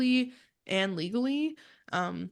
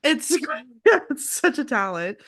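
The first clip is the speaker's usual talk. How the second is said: sarcastic laugh; 5 semitones higher, 6 dB louder than usual. Real, not acted.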